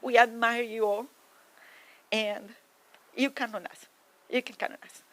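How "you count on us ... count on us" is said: ordinary delivery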